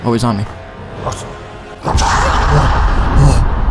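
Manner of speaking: ordinary talk, nothing marked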